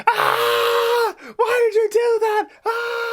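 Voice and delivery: very high, loud mock scream of pain; retold pained screaming. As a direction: wail in exaggerated agony, imitating a hurt friend. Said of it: "he fell to the ground screaming".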